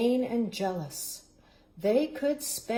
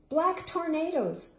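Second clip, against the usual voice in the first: usual speaking voice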